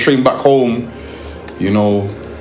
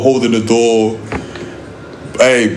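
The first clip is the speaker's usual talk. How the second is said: ordinary talk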